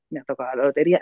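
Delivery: joyless, mismatched telling; unenthused. Shown as a demonstration. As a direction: say the good news as if it meant nothing. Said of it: "y la segunda vez".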